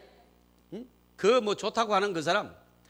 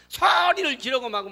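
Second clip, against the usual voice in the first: mock yelling; 8 semitones higher, 4 dB louder than usual. Imitates quarrelling shouting.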